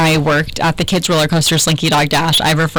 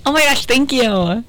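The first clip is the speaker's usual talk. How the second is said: high, slow gushing "thank you"; mock surprised thanks; 6 semitones higher than usual. Retelling her surprise party to cohost, high mock gush. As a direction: play it like you're truly surprised. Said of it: "And you said?".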